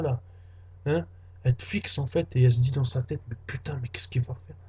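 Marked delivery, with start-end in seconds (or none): 3.3-4.6 whispered inner thought; a girl wondering in a hushed whisper. Acted. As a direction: breathe it as a secret thought.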